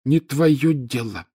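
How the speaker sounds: put-on high, slow, snippy ghost girl; a ghost girl cutting him off; curt ghost girl. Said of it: "отрезала Миртл".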